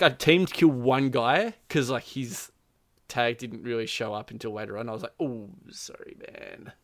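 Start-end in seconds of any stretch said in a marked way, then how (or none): 5.2-6.7 hushed, barely voiced sorry; sheepish apology. Player apologising to teammate he shot, sheepish hush. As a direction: wince and apologise under your breath.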